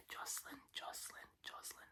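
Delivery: very soft ASMR whisper; whispered name repetition. Shown as a demonstration. ASMR host repeating a viewer's name, whispered.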